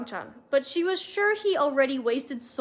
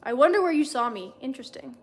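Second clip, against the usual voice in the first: plain reading voice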